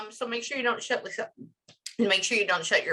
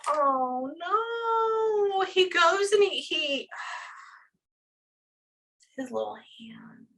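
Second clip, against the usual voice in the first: high, breaking voice fading into a tearful whisper; fighting back tears as the image hits; choked up crying; 10 semitones higher, much slower than usual. Real, not acted. Medium to grieving family, crying over his little hand. Medium overcome while describing death.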